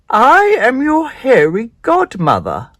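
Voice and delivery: high, slow, grand godmother voice; high spider godmother. Acted. Hairy godmother introducing herself to Spinderella, slow and grand. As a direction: announce yourself slowly like a magical godmother.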